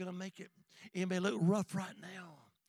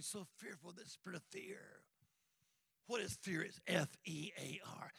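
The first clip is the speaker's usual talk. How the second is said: normal talking voice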